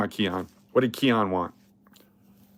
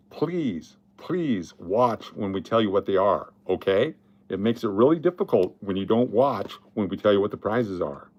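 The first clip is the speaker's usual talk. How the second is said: stern request; 4 semitones lower, 3 dB quieter than usual. Real, not acted. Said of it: "I'm just gonna say this one time".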